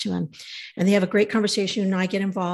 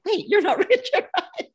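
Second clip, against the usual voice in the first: high, laughing mock doubt; laughing disbelief. To the twin brother, half-laughing, high and amazed. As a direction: laugh through the question as if it's a prank. Meeting Richard's identical twin.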